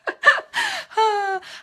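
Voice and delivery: sudden high laugh at herself; real high-pitched laugh; high burst of laughter. Real, not acted. Laughs at her own rambling.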